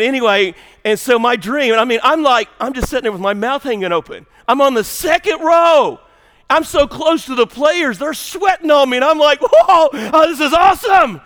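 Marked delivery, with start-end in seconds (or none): 4.5-11.2 loud and high with wonder; excited shout. Real, not acted. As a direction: relive the thrill at full volume. Reliving sitting courtside near players.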